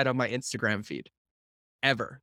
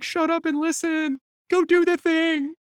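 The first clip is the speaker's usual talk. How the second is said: high, slow, drawn-out order; hammed-up exasperated encouragement; mock scolding; 3 dB louder, slower than usual. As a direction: order them off in a playful high voice. Retold playful scolding reply.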